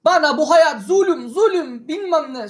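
high, laughing mock whine in a thick regional Turkish accent; comic regional accent. Laughing at the indebted client, he mimics his whiny regional-accent complaint. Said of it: "şive falan çok komik".

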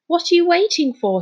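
high, impatient demand; angry. A narrator's reading. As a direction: demand it with exasperation. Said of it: "he said angrily".

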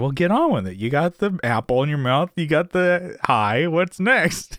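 high, pushy hurry-up; impatient prodding. Retold or imitated. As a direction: nudge him to get on. Jerry voiced impatient.

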